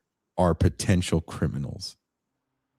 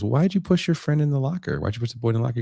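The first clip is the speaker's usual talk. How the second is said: questioning guard; 7 semitones higher, much faster than usual. Retold or imitated.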